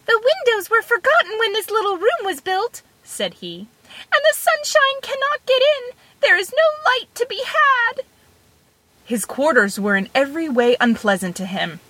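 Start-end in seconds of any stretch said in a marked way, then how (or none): 0.0-2.8 high, small grumbling voice; tiny Tom Thumb. A narrator's reading. Tom Thumb complains inside the cow, high and wry. 4.1-8.0 high, small grumbling voice; tiny Tom Thumb. A narrator's reading. Tom Thumb complains inside the cow, high and wry.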